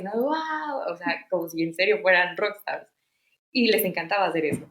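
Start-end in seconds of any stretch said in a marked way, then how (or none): 0.1-0.9 high mock cheer of wow; cheering whoop. Nanny cheering the girls' show with a high whoop. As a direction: whoop like the crowd at a rock show. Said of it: "que gritarles".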